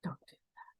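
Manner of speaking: soft earnest whisper; whispered warning. Real, not acted. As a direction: whisper it as a plea. Warning after quoted vow.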